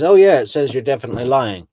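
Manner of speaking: smug interrogator. Retold or imitated. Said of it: "come back with a printout and says".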